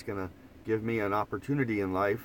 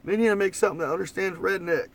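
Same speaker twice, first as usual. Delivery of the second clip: high, exasperated Oklahoma twang impression; fed-up friend griping in a thick Oklahoma twang; 8 semitones higher than usual. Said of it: "it has a real Oklahoma twang".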